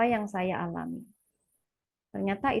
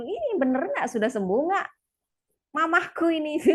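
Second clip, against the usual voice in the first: put-on teasing child; 5 semitones higher than usual. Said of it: "Anak saya itu kayak menggoda".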